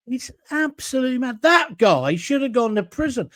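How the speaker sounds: usual speaking voice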